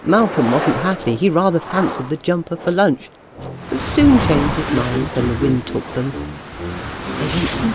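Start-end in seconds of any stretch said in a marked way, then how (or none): none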